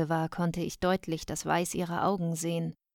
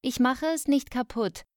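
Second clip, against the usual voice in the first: put-on high, protesting child's call; calling back; 7 semitones higher, 4 dB louder than usual. A narrator's reading. Daughter calling back to her scolding mother, protesting. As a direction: call back in a raised, defensive voice. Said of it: "rief ich zurück".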